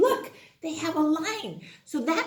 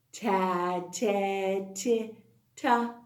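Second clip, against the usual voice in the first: usual speaking voice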